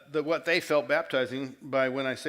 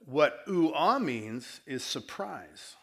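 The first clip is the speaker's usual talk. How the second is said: ordinary talk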